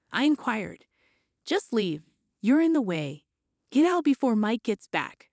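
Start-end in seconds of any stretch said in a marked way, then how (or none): none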